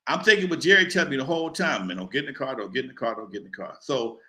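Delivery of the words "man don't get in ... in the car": a warning dying away in memory; echoing warning. Retold or imitated. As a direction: lower and fading softer each time. Jerry's warning replaying in head.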